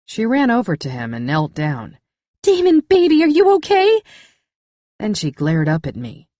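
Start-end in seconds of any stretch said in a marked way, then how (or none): 2.4-4.0 high, loud panicked worry; frantic girlfriend. Acted. Cass kneels over hurt Damon, high frantic worry. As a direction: fuss over him in a panic.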